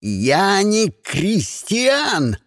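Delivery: drawn-out enraged snarl; growled roar. A narrator's reading. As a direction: roar it word by word. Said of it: "прорычал Сиприт".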